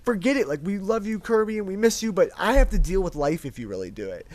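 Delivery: loud, high, urgent pleading; retold tearful plea. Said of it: "I was, like, crying".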